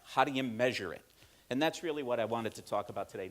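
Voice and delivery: ordinary talk, nothing marked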